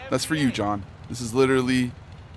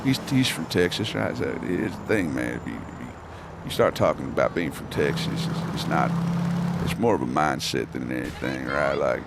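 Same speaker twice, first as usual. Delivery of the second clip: Matthew McConaughey; 11 semitones lower, 5 dB quieter than usual. Acted. Streamer doing a Texan voice on request, low McConaughey impression. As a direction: lean into a laid-back, very low Southern murmur. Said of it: "maybe I'll do Matthew McConaughey".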